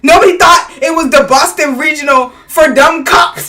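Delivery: loud, rising, high disbelief; exasperated shout. Real, not acted. Ranting to a friend about the meeting, loud and incredulous.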